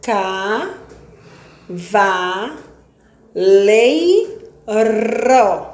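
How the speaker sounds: "Pé, te, ca" sílabas destacadas, com pausas; silabando. Shown as a demonstration. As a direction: bata cada sílaba de peteca. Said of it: "mais de quatro sílabas". (separando sílabas para a turma)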